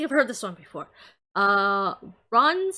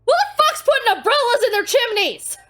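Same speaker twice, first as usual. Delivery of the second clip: high, loud incredulous shout; laughing outrage; 13 semitones higher, 10 dB louder than usual. Real, not acted. Streamer venting at the umbrella answer, loud outrage. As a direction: yell it in amused disbelief.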